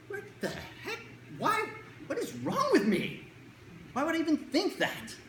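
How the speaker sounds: high, startled and confused, asking yourself; baffled inner voice. Retold or imitated. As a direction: react as if a strange thought just hit you. Confused inner voice reacting to thought.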